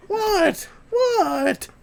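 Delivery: very high, loud put-on dog voice. Owner voicing his dog at chat, very high loud voice. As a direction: bark the question as the dog.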